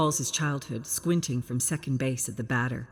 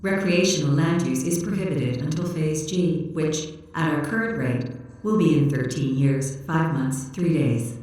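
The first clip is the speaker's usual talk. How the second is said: louder, even machine voice reciting facts; robot unit voice; 5 dB louder than usual. Acted. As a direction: read it out like a literal-minded robot.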